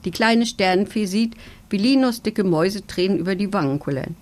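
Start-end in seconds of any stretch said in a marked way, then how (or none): none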